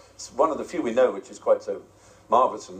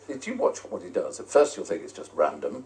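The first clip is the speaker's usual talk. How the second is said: ordinary talk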